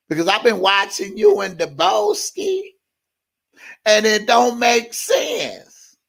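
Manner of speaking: imitating critics. Imitating YouTube critics who lecture him, mocking and high. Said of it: "I'm gonna imitate these motherfuckers".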